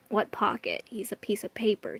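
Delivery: hushed aside; whispering. Real, not acted. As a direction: whisper it under your breath.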